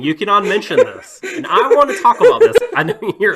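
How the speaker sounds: loud, high, rising outburst; exasperated playful protest. Real, not acted. Host to laughing co-host about voice acting, loud mock protest. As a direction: protest loudly through amusement.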